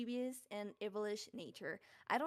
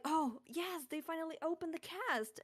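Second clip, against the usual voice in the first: retold excitement; 7 semitones higher than usual. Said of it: "I was, like, so excited".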